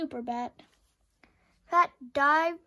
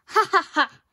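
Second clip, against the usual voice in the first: loud, higher ha ha ha; mocking bat laughter; 4 semitones higher, 12 dB louder, faster than usual. A narrator's reading. The bats laughing at Pat, loud mocking laugh. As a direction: laugh him down as a crowd. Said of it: "the bats all laughed".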